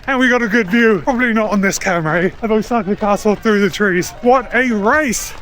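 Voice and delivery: ordinary talk, nothing marked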